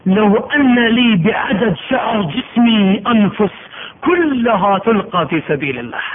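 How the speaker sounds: slow, drawn-out longing; slow yearning. Acted. Martyr tells Caesar his wish, slow and yearning. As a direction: stretch each word like a vow.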